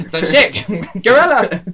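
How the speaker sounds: startled cry cracking into laughter; laughing yell. Acted. Climber spotting the gorilla on Ayers Rock, laughing shout.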